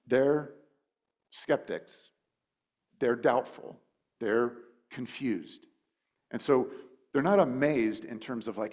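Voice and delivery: ordinary talk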